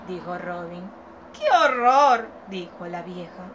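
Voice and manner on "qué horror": put-on old woman horrified by the baby; disgusted old woman. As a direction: recoil like a fussy old lady.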